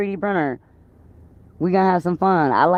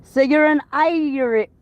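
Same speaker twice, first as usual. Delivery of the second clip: higher, slow attempt at Irish accent; 7 semitones higher, 3 dB louder, slower than usual. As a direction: put on a clumsy Irish accent. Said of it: "I'm trying to use an Irish accent".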